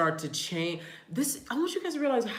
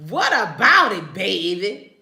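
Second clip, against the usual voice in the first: loud, hammed-up New Orleans talk; New Orleans accent; 10 dB louder, slower than usual. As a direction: imitate New Orleans relatives, playfully loud. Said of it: "That's how they talk".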